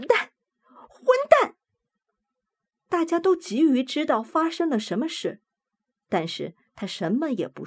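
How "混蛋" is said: furious high-pitched yell; angry shout. Acted. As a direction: spit it out in fury. Character's sudden outburst.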